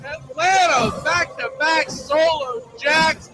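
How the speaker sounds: high, slow, roaring home run shout; home run call. Real, not acted. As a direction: belt it out like the winning homer. Home run call, crowd music.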